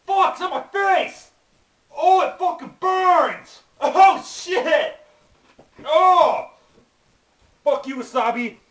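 high, loud burning-pain screams; screaming in pain. Real, not acted. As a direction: scream as the burn hits. Wasabi on his face burning.

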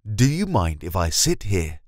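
ordinary talk, nothing marked

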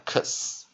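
"cus" said as two consonants, k then s; sounding out X. Shown as a demonstration. Teacher showing X makes two sounds, "cus" sounded as k-s. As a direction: draw out the k-s blend in "cus". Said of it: "所以它会变成两个音出来".

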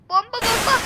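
high, loud warning call; alarmed call. Real, not acted. Gamer warning of bombs, high loud alarmed call. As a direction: shout the warning at the screen.

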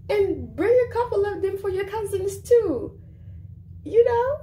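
high-pitched, loud, playful dismissal; mocking send-off. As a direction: pile on the mockery in a high voice.

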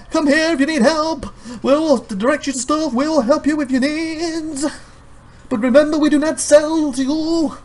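high, slow announcer's sing-song; mock announcement. Help-desk sign addressing shoppers, high announcer. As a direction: proclaim the service like a sign.

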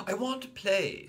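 put-on high loud childish demand; high whiny I want; insistent little boy. A narrator's reading. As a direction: insist stubbornly.

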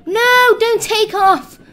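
high, loud, drawn-out protest; pleading shout. Pretend. Player calls after a departing helicopter, desperate shout. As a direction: yell after it as it leaves.

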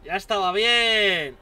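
loud, slow ya estaba bien; loud relief. Real, not acted. Player loudly relieved after beating the boss. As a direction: draw out the relief.

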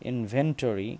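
ইনভেন্টরি stressed in-VEN-tory; the common wrong stress on ven; wrong pronunciation. Shown as a demonstration. Teacher showing the wrong ইনভেন্টরি. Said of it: "তাহলে আমাদের ভুল হবে".

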